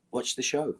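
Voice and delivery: a would-be stern piece of advice, barely holding back a laugh; mock-serious advice. Shown as a demonstration. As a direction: say it as if it were the most obvious thing. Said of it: "Even I can't keep a straight face to that".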